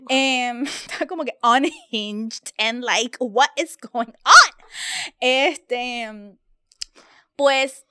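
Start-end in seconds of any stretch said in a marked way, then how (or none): 3.2-4.7 slow, much louder, stretched-out disbelief; mock bewildered yell. Imitating the unhinged actor, a slow, loud, baffled cry. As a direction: drag out the question in loud disbelief.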